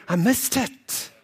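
slow, high mock-sulky complaint. Friend who missed out while others laugh, mock sulk. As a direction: act out feeling left out, drawn out and high. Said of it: "you sitting there, dick pic".

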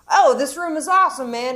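impressed friend. Retold or imitated. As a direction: gush about the room like a dazzled guest. Voicing friend admiring the room.

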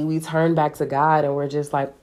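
usual speaking voice

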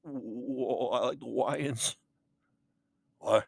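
soft lisping cartoon hunter; lightly voiced Elmer Fudd, soft and timid. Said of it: "what about you, Elmer".

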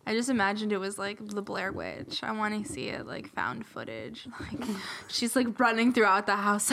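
normal talking voice

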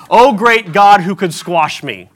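slow, hammed-up awestruck prayer to a mighty God; mock grovelling prayer. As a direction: pray it out slow like a trembling subject. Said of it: "every time you pray, it should be".

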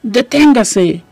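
loud, slow command to stop; stern order. A narrator's reading. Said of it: "ordenó".

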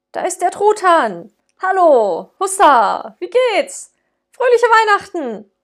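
high, loud, jubilant holiday cries; overjoyed old man hailing the street; joyful calling out. Acted. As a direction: shout the greetings out the window with glee. Scrooge calls out joyfully.

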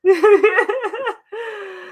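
squealing laughter; high laugh. Real, not acted. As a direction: laugh with delight. Laughing at dog.

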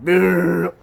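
loud, high brrr of spiraling down; lip-rattle spiraling noise. Retold or imitated. Mimicking a drug user spiraling down with a loud brrr. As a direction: blow a loud lip trill. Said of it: "you'll be like".

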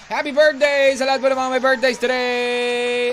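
cheery announcer. Acted. Radio host greeting listeners.

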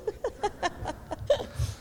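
quiet embarrassed giggle; soft breathy laugh. Real, not acted. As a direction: laugh it off softly. Flustered after losing her place.

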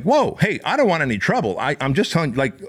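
high-pitched retold plea to calm down; placating. Bouncer backing off from aggressor.

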